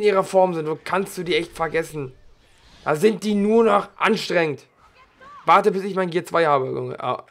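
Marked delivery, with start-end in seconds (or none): none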